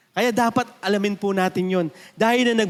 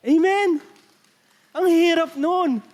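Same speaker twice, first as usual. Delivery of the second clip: emphatic call; 7 semitones higher, 5 dB louder, slower than usual. Real, not acted. Pastor calls to congregation.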